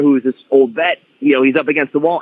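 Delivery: ordinary delivery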